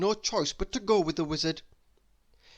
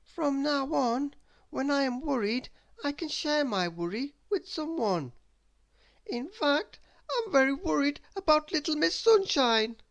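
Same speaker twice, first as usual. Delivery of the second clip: Mister Worry's voice; 11 semitones higher than usual. Acted. Mister Worry talking to himself, high and fretful. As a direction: fuss aloud about your worries.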